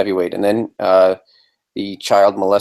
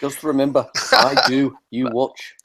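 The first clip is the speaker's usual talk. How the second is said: lightly put-on Liverpool voice; mock Liverpool accent; 4 semitones higher than usual.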